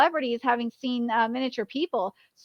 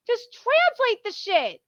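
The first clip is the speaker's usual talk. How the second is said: slow, high, exasperated demand; 9 semitones higher, slower than usual. Real, not acted. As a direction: drag it out in frustration.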